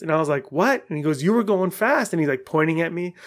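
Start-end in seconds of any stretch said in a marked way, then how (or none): 0.5-0.8 high, indignant "what"; indignant retort. Retold or imitated. Acted roadside quarrel. 1.2-2.1 higher-pitched finger-pointing retort; mock accusation. Acted roadside accusation.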